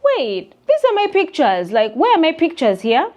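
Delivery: high, loud startled disbelief; shocked realization. Retold or imitated. Vlogger retelling finding her pictures reposted, shocked. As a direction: act out catching them using her pictures.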